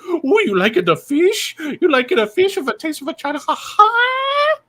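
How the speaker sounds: high, fast mock Chinese accent. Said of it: "the Chinese food bit".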